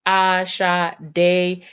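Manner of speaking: Ashade broken slowly into A, sha, de; slow syllable-by-syllable Ashade, as a teacher demonstrating; split into syllables. Shown as a demonstration. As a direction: say Ashade in three separate, drawn-out parts. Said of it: "it has three parts to it".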